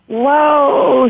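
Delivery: a loud, high whoa of wonder; excited cry. Acted. Dying friend seeing something wondrous, excited cry. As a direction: cry out in sudden amazement. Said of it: "she cried out".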